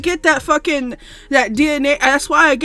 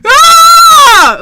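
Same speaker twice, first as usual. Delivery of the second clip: high, loud raging scream; furious shout; 11 dB louder, much slower than usual. Real, not acted. Gamer screaming at the game after a loss, furious shout. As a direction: let it rip at the screen.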